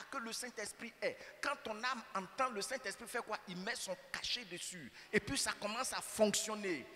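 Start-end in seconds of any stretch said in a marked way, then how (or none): 5.1-6.9 louder, driving sermon delivery; full-force preaching. Acted. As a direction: hammer each line home to the congregation.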